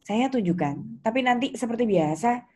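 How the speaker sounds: ordinary talk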